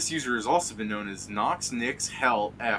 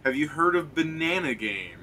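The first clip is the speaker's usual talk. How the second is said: higher, louder mock-earnest protest; imitated earnest scammer; 5 semitones higher, 6 dB louder than usual. Retelling the scammer's reply to friends, mock-earnest insistence. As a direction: lightly mimic an insistent recruiter. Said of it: "the guy just goes".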